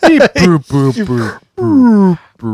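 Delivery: toy robot voice. Pretend. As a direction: chant beeps like a toy robot. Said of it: "Gotta make the noise".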